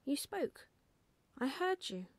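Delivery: hint of an eager child's voice; insistent owlet. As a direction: pester a sleepy dad, lightly childlike. Owlet pestering his sleeping father.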